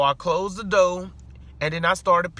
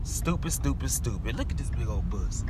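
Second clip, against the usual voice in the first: low, quiet grumble at a bus; real road annoyance, low and quiet; muttered road rage; 8 semitones lower, 7 dB quieter than usual. Real, not acted.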